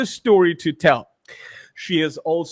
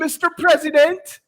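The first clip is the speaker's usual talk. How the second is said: excited; 8 semitones higher than usual. Acted. Photo with Trump shown.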